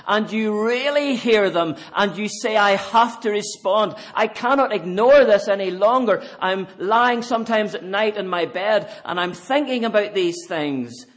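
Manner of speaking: loud, urgent pulpit preaching; preacher pleading with the congregation; preaching at full force. Acted. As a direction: press the call on the listener. Fervent appeal resumes.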